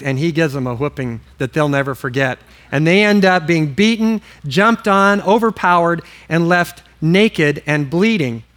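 normal talking voice

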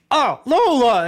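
high and loud mock protest; exasperated call. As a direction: call out to grandma in fake outrage. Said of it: "and I'm like".

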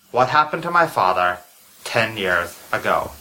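low, grave masked mastermind; low villain voice. Acted. Masked doctor continues reveal.

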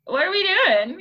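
high, puzzled pet voice; dog voice. Retold or imitated. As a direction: voice the confused dog. Voicing the dog Steve.

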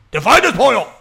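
put-on high, loud Mexican-accent outburst; mock waiter's cry. Waiter driving the order home to the kitchen, hammed-up loud Mexican-accent cry. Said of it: "a waiter going to the back and be like".

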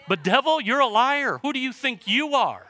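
high, loud challenge to the devil; defiant rebuke. Acted. Preacher acts out rebuking the devil.

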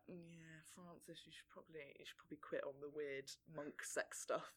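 quiet, sheepish aside; hushed mock advice. Imagined advice to Dashwood, hushed.

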